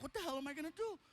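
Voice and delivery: high-pitched mock-tearful wail; retold crying. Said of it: "I'm crying".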